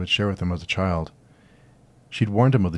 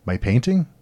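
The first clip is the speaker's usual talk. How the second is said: stunned; 5 semitones higher, 4 dB louder than usual. Acted. Stunned by speaking painting.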